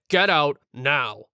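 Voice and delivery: loud, slow teacher's order; stern order. Retold or imitated. Teacher throwing Kyle out of class, stern order. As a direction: order him out, loud and drawn out.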